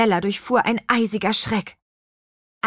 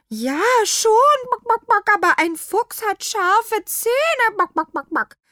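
gurgling hen; 4 dB louder than usual. A narrator's reading. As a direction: cluck a nervous warning. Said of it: "gluckerte Rosenrot".

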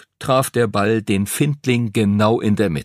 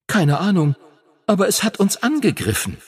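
put-on lightly higher young dragon, startled; a dragon voice pitched up in alarm; 9 semitones higher than usual. A narrator's reading. Phoenix to Henry after the attack, higher and startled.

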